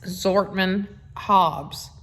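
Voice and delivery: ordinary talk, nothing marked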